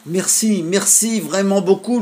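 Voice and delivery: put-on high, loud thankful little boy; boy shouting thanks. A narrator's reading. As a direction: call out thanks across the water. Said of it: "lui cria-t-il". (garçon qui crie merci, voix aiguë)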